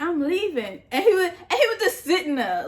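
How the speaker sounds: high, laughing through it. Real, not acted. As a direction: tell it while laughing. Laughing retelling exit.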